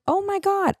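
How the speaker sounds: high squeal of mock delight; imitating her own excited high-pitched squeal; squealing excitement. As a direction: gush like you found treasure. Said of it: "I was like".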